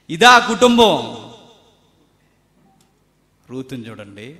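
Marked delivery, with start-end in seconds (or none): 0.1-1.2 ఆ hit higher and louder in a falling proclamation; loud preached repeat of ఇదే ఆ కుటుంబం. Shown as a demonstration. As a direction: proclaim it, hitting ఆ hard.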